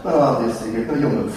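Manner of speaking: low, listless voice of someone who has given up; depressed. Acted. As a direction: act too depressed to care, pitch sunk low. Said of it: "really depressed right now".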